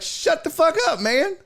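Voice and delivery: high-pitched mock yell; exasperated, high retort without full volume; retold heckler comeback. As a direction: replay snapping at a heckler, pitch jumped way up. Repeats his comeback to the heckler.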